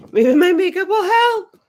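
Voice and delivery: high, loud comic exclamation; big silly high voice; hammed-up outburst. Sudden mock plea, loud and high.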